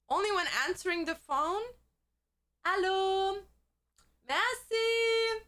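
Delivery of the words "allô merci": high, drawn-out French accent; over-extravagant French. Shown as a demonstration. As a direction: play up the French with held vowels. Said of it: "over extravagantly speak when I speak French".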